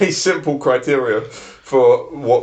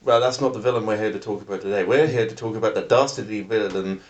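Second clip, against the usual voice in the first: ordinary talk, nothing marked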